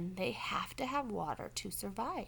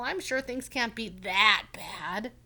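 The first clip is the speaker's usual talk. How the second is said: reassuring; 9 dB louder, slower than usual. Acted. Mister Mole reassuring Franklin.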